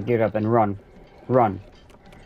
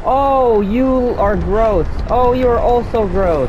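loud disgust; 9 semitones higher, 5 dB louder, slower than usual. Real, not acted. Disgusted by game monsters.

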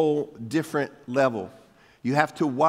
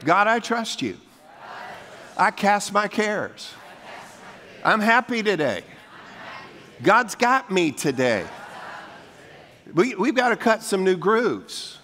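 preacher proclaiming each affirmation with rising force; bold declarations; 5 semitones higher than usual. Shown as a demonstration. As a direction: declare it with faith. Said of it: "How about this?".

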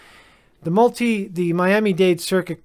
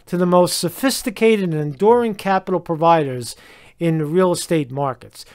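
ordinary talk, nothing marked

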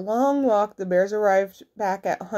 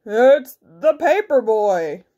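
put-on loud, drawn-out paper boy call; fibbing wolf; 6 dB louder, slower than usual. A narrator's reading. Wolf fibs at Granny's door, loud fake paper boy. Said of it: "Big Bad Wolf fibbed".

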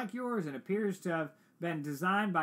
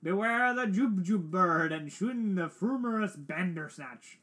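theatrical verse reading, high and loud at first; poem recitation; 5 semitones higher, 6 dB louder than usual. Acted. Player reciting the Jabberwocky, hammed-up poem recitation. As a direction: declaim the nonsense poem grandly.

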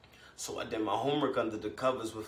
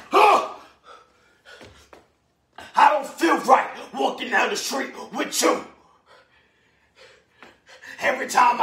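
raging father; 12 semitones higher, 10 dB louder than usual. Acted. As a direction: stammer building into a high, loud shout. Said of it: "telling her all about his woman, saying".

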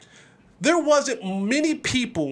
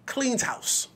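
usual speaking voice

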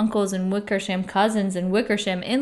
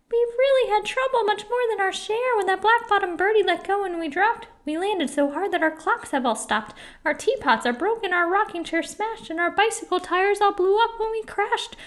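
high-pitched little mayor, pleading; pleading tiny mayor; 10 semitones higher than usual. A narrator's reading. Mayor begs Horton after the crash, pleading. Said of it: "pleaded that voice of the mayor's".

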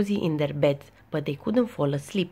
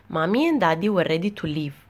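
plain reading voice

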